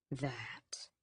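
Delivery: hushed, low menacing growl; dangerous growl. A narrator's reading. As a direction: threaten under the breath. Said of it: "he growls dangerously".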